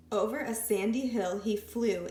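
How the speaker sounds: ordinary delivery, nothing marked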